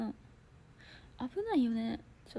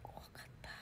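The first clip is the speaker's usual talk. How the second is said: whispered fear; 19 dB quieter, faster than usual. Real, not acted. Quiet aside about fear.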